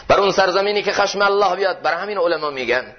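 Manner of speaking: ordinary talk, nothing marked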